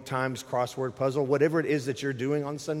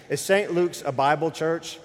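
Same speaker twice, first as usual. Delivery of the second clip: raised, higher challenge to the room; mic-check repeat; 4 semitones higher, 4 dB louder than usual. Real, not acted. Preacher re-asking an unresponsive congregation, raised and insistent. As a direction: ask it louder, pressing for an answer. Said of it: "Is this microphone on? Check, one, two".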